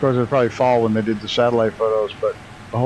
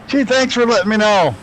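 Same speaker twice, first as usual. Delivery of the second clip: higher and louder, mock-grateful; dripping sarcasm at the chat; sarcastic thanks; 10 semitones higher, 4 dB louder than usual. Real, not acted.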